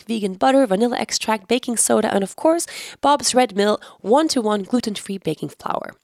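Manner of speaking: bright, upbeat sponsor read. Acted. Host reading a sponsor spot to viewers, upbeat ad read. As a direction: sell the brand with a smile.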